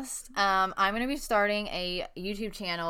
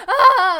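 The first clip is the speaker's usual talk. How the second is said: high loud shriek breaking into a laugh; retelling the dream of piss poured on her, laughing shriek; imitated yell; 12 semitones higher, 15 dB louder than usual. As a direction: scream as if doused, then laugh. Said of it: "like, yelling".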